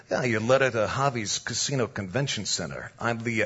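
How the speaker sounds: low, soft East Coast wiseguy; mafia tough guy. Retold or imitated. Mobster explains his casino job, low wiseguy voice. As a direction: talk low like a mob boss. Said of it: "he says it just like this".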